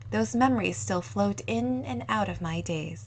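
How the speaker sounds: plain reading voice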